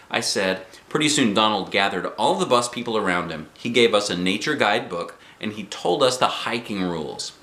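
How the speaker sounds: plain reading voice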